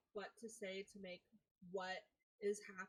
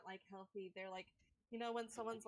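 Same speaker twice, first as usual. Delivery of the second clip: ordinary delivery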